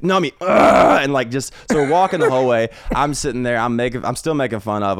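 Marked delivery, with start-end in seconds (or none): 0.4-1.0 loud imitated growl of rage; hammed-up exasperated groan; frustrated growl. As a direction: mimic a fuming man's wordless ugh. Imitates the angry man's growl.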